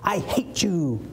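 a touch of mock spite; mock hateful. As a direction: toss it at the sister. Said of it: "go to your sister".

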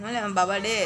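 tender murmured pet talk. Real, not acted. Softer cooing to dog.

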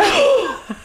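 sharp, very high gasp of shock; mock shocked gasp. Retelling dad's deadpan joke, a high shocked gasp.

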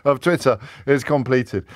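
laughing mock hurry-up; joking urgency. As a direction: hurry them along jokingly, breaking into a laugh. Joking urging through laughter.

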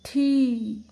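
थी loud, with a long ee; long-vowel demonstration. Shown as a demonstration. Teacher joins letter and matra, long loud थी. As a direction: hold the vowel in थी, loudly. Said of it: "बड़ी की मात्रा".

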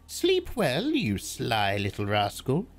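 put-on high, sly old witch; old crone voice. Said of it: "said the old woman".

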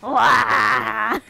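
loud rough orc shout; orc roar. Retold or imitated. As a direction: bellow like a charging orc. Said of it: "my orc impression".

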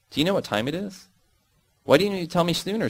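high, sharp angry questions; angry exclaiming. Acted. Woman scolding about being late, high and angry. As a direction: snap at her for the late hour. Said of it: "she exclaims angrily".

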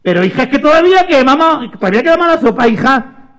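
high, fast scolding; fed-up mum, quick and high; exasperated mother. Acted. Mother scolds María about soup.

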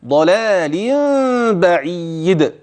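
ضلال بعيد chanted as "dalālimm-baʿīd", nasal m held; hidden mīm with ghunna. Shown as a demonstration. Teacher showing ghunna and qalqala in ضلال بعيد. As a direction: hum from ضلال into بعيد, ending on a bounced د. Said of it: "أخفيت هذه الميم مع وجود غنة".

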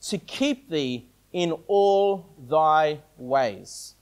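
very slow, low and soft, word by word; slow reading. Real, not acted. Preacher showing the words Satan left out, very slowly. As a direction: spell out the verse slowly, each word set apart. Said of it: "I'll read it slowly".

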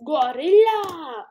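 loud, slow, drawn-out yell; loud exclamation. Real, not acted. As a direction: belt out the word. Exclaiming at the gorilla.

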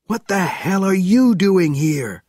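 shouted over a crowd noise; shouting. A narrator's reading. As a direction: yell it right in his face. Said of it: "shouted into Panov's face".

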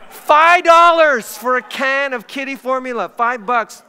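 loud, high, drawn-out disbelief; comic outrage. Acted. Pastor telling the church what kitten formula cost, loud comic outrage. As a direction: cry out the price as if scandalized.

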